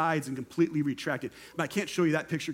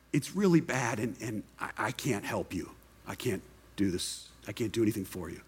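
much lower and softer, a grim, hopeless admission; defeated surgeon; 7 semitones lower, 4 dB quieter than usual. Retold or imitated. Surgeon telling the patient he can't help, low and hopeless. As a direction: let the voice sink as he gives up.

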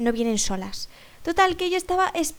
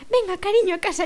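put-on higher-pitched older-lady call; acted coaxing; 6 semitones higher, 3 dB louder than usual. Older woman to supposed granddaughter, coaxing call. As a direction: summon a child home in a raised voice. Voices the woman calling her home.

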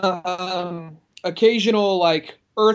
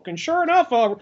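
tourist voice; 8 semitones higher than usual. Retold or imitated.

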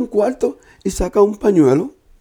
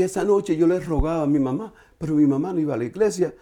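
ordinary talk, nothing marked

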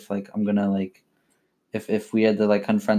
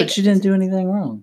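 much higher, louder protest; real outraged sympathy; indignant defense; 9 semitones higher, 3 dB louder than usual. Real, not acted. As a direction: stand up for her. Friend defending her.

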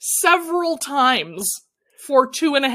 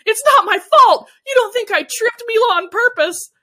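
high, loud mock-defensive cry; hammed-up high, indignant denial; stunned protest; 11 semitones higher, 9 dB louder than usual. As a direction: shout it like an accused drama queen. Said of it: "gets this stunned look on her face and goes".

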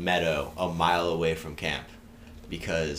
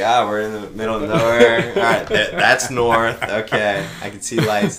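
loud, higher retold self-talk; mock bravado; 4 semitones higher, 10 dB louder than usual. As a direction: play getting your bearings out loud. Acts out finding his bearings.

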